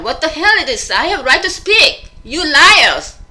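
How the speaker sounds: high, loud outburst building to an accusation; acted-out yell. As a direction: build to an indignant shout, replaying the anger. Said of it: "almost like a yell".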